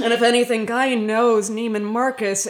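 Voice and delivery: ordinary talk, nothing marked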